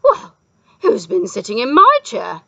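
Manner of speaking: loud, indignant howl; howling mother bear. A narrator's reading. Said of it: "howled Mother Bear".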